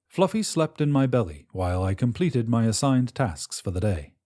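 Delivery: usual speaking voice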